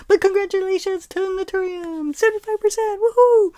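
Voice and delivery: high, loud cheering congratulations; hyped giveaway announcement. Real, not acted. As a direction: celebrate the winner at full volume. Cheering the winning brand.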